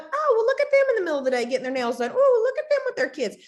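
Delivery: snide, fast and high, rising to a squeak; mocking envy. Mocking envious onlookers comparing themselves to successful women. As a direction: sneer at them like a jealous onlooker.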